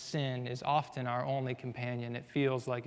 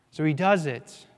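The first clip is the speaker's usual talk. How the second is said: normal talking voice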